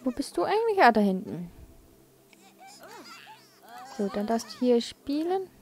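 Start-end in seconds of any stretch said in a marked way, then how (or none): none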